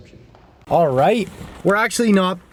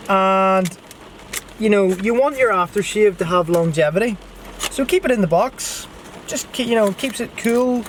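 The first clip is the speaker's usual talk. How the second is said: usual speaking voice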